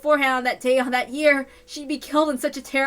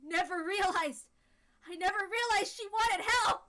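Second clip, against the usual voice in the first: tearful; 7 semitones higher, 8 dB quieter than usual. Acted. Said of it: "I didn't realize I was in tears".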